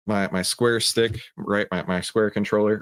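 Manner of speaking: ordinary talk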